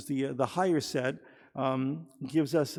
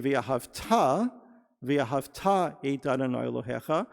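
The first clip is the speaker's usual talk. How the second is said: "ve'ahavta" as ve-a-hav-TA; stress on last syllable; 2 semitones lower than usual. Shown as a demonstration. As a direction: land firmly on the final TA of "ve'ahavta". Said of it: "accent is on the last syllable".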